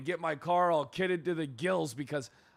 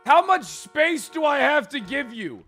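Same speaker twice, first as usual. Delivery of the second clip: loud annoyed higher yell; exasperated shout; 11 semitones higher, 11 dB louder than usual. Acted. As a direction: yell it, fed up with them. Annoyed character yelling at someone.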